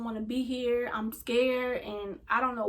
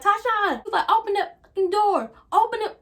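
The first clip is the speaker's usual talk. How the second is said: furious shouting, much higher and louder; angry yell; 9 semitones higher, 6 dB louder than usual. Retold or imitated. Mom yelling at daughter through the bathroom door, furious. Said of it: "She was so angry".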